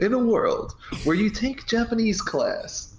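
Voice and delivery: slow, high, dramatic trailer narrator; movie trailer voice. Pretend. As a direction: announce it like an epic film trailer. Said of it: "You're doing, like, some kind of movie trailer voice".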